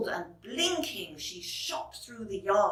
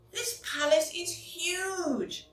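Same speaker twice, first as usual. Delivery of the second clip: fairy voice awed; 9 semitones higher than usual. Acted.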